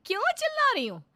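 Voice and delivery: daughter-in-law mocking the sister's question with a shout; mocking echo. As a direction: echo क्यों चिल्ला रही हो back as a shrieking mock. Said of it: "चिल्ला चिल्ला कर बात करने लगती है".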